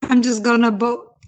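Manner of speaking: normal talking voice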